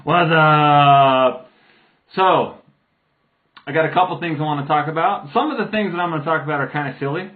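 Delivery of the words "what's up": loud, long-held "what's up"; goofy drawn-out holler. Pretend. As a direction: stretch the greeting out in a big holler. Silly drawn-out greeting to viewers.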